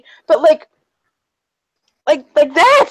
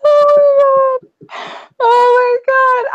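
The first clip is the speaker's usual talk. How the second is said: slow, high, near-tearful oh my god; overwhelmed joy; 8 semitones higher, slower than usual. Real, not acted. Streamer overcome by a surprise drawing, tearful joy.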